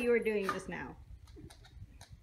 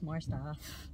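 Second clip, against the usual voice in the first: more stuff trailing off low and soft; fading mutter; 7 semitones lower, 7 dB quieter than usual. Shown as a demonstration. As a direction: let more stuff die away quietly. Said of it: "repeats phrase fading".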